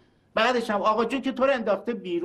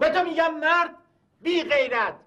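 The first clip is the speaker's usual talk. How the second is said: angry insults; 6 semitones higher than usual. Acted. Older brother hurls insults at younger brother, angry. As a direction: spit each insult at him.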